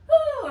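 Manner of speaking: loud high whoop of relief; relieved whoop. Real, not acted. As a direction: let out a triumphant whoop. Relief after the set.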